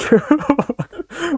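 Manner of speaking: high-pitched giggle; squeaky burst of laughter. Real, not acted. Laughing at a clip.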